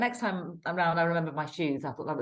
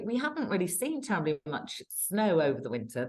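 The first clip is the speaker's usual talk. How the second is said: ordinary talk, nothing marked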